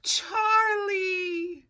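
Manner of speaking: high loud joyful cry of a name; joyful shout. Acted. Girls spotting their lost cat on the stairs, joyful shout. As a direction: shout it out in relief. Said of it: "Sarah and Elizabeth cried".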